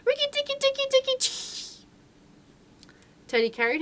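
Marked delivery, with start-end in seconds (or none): 0.0-1.9 much higher chattering cry ending in a breathy chee; a little animal's battle cry; mongoose war cry. A narrator's reading. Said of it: "his long war cry of".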